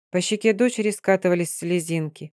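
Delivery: ordinary delivery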